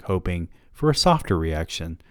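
usual speaking voice

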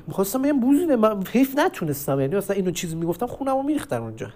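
ordinary talk

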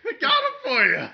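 loud, high manic salesman; unhinged villain. Pretend. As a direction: cackle the offer like a lunatic. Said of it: "We both sound like madmen".

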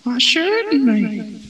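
slow high sleazy purr; creepy stranger voice. Acted. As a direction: drawl it like a creep.

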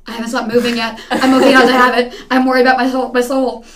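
loud, half-laughing mock frenzy; hammed-up caffeine jitters; over-caffeinated panic. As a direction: blurt it loudly through laughter, playing frazzled. Retells being over-caffeinated and jittery.

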